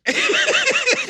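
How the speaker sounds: high loud burst of laughter; big laugh. Real, not acted. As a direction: crack up hard and high. Laughing at own joke.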